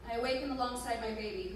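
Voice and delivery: plain reading voice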